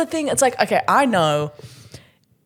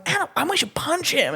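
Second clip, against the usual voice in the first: high, eager suggestion; higher, bouncy glee; mock eager violence; gleeful escalation; 10 semitones higher than usual. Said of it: "And I'm going".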